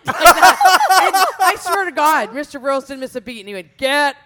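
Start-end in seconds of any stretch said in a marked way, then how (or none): 0.1-2.3 laughing through the words; cracking up while telling it. Real, not acted. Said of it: "I thought that was pretty funny". 3.8-4.2 sharp command. Retold or imitated. Teacher ordering student out.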